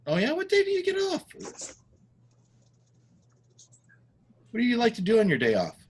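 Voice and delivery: high, chatty questioning; friendly visitor. Acted. Visitor asks girl about her day off, chatty.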